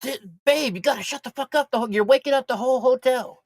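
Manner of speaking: retold high-pitched shushing plea; mock pleading scolding. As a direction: plead with a noisy friend to shut up. Acting out hushing a loud friend.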